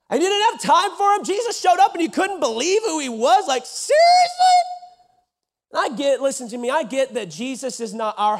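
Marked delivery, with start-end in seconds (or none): 3.5-4.6 high, loud disbelieving cry; incredulous shout. Acted. Mocking Nazareth's disbelief.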